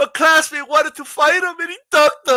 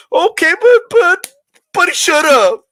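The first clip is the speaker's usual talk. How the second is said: high, louder whining protest; mock whiny hater; 6 semitones higher, 6 dB louder than usual. Streamer voicing a sore fan arguing back, high whiny protest. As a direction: sputter like a sulking fan.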